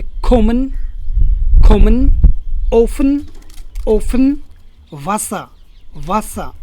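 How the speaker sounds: खोमन, ओफन, वासा with short first vowels. Shown as a demonstration. Teacher drills double consonants, short vowels in खोमन, ओफन, वासा. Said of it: "हमेशा short sound बोलता है".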